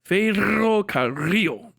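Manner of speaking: Ferrocarril drawn out with long trilled Rs; rolled Rs. Shown as a demonstration. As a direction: take it slow and trill both Rs in Ferrocarril. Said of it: "those R's rolled".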